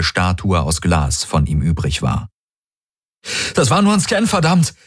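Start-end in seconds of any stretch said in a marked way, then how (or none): 3.5-4.7 high-pitched, breathless outcry; breathless shout. A narrator's reading. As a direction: curse in breathless alarm. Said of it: "rief Galen atemlos und mit donnerndem Herzen". (atemlos und aufgeregt gerufen)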